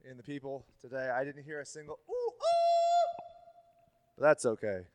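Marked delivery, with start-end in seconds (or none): 2.1-3.2 squeaky falsetto ooh ooh; a high, spooky hoot; high mock ooh. Shown as a demonstration. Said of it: "I maxed out the volume on this motherfucker doing that".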